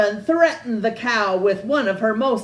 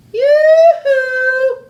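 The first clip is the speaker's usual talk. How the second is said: high, loud calling yoo-hoo; shouting; 11 dB louder than usual. A narrator's reading. Witch calling out across the meadow, loud and high. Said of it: "she shouted".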